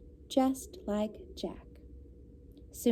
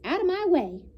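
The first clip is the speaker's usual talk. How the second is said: bossy goose; 7 semitones higher than usual. A narrator's reading. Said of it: "cried the goose".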